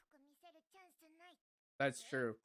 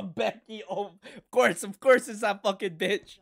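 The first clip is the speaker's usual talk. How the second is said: ordinary delivery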